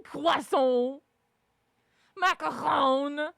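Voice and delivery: drawn-out, pretentious French pronunciation; mock people who say it the French way; exaggerated French pedant; mock French accent. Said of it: "you sound like a dick when you're saying".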